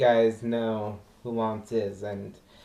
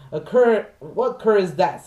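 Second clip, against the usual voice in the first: mock outcry; 9 semitones higher, 6 dB louder than usual. Quoting indignant diners.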